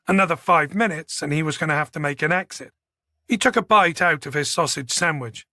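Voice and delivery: ordinary delivery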